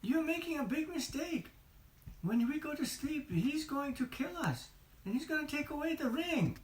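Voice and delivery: high anxious Sam voice; worried Sam. Acted. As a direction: sound alarmed, plead with your friend. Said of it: "He said".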